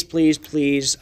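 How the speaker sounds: ordinary talk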